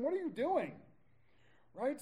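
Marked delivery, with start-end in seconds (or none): 0.0-0.7 pitched up, louder, alarmed question; startled priest calling out the thieves; startled challenge. Retold or imitated. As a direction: catch them in the act.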